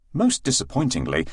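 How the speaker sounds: usual speaking voice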